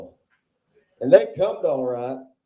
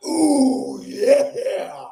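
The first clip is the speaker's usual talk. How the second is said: higher, louder crooned ooh yeah; hammed-up ooh yeah; 14 semitones higher, 5 dB louder than usual. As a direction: croon it like a cheesy showman. Closing catchphrase.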